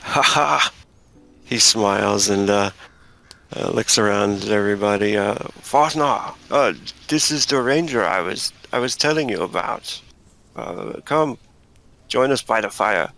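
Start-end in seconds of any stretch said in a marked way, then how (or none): 0.0-0.7 higher, short "ha ha" laugh; hearty acted laugh. Hunter greets the ranger in character. 5.6-13.1 higher-pitched hunter voice with swooping tune; welcoming hunter. Acted. Plays the hunter greeting the ranger.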